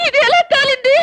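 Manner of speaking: high-pitched shocked question; shocked outcry. Acted. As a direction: cry out in alarm at the damage. Wife discovers the burnt garment.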